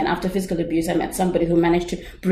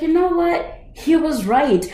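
sudden realization; 9 semitones higher than usual. Retold or imitated. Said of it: "And I was like".

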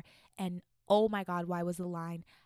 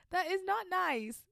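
much higher and slow, stressing "not"; high scolding; 10 semitones higher, slower than usual. Real, not acted. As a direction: scold the confessor, drawn out. Scolding the confessor.

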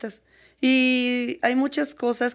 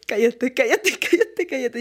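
urgent hush through laughter; laughing hush; 3 semitones higher than usual. Retold or imitated. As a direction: hush her while laughing. Friend hushes her, laughing.

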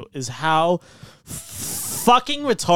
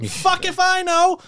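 much higher, slow laughing shrug; 14 semitones higher, slower than usual. Real, not acted. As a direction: laugh it off, clueless. Laughing high reply.

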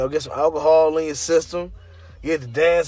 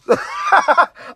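high laugh at own joke; burst of laughter; 5 dB louder than usual. Real, not acted. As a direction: break out laughing.

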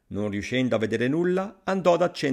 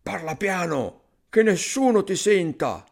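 alarmed thief, raised pitch; frightened urging; 7 semitones higher, 4 dB louder than usual. A narrator's reading. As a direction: urge him anxiously to keep it down. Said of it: "I ladri dissero spaventati".

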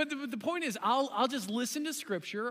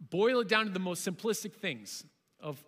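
usual speaking voice

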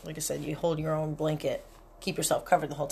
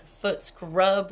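ordinary delivery

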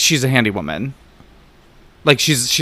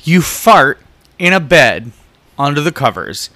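ordinary delivery

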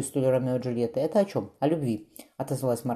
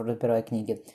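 plain reading voice

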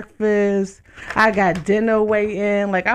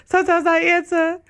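laughing through the confession; 8 semitones higher than usual. Real, not acted. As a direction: crack up as you admit it. Admits talking to herself.

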